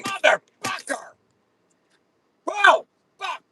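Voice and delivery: high, loud outburst of rage; furious yell. Real, not acted. Alone with a runaway bill, he screams in fury. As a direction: explode at the costly bug.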